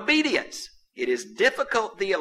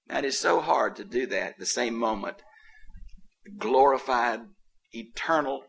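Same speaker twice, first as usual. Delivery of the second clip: usual speaking voice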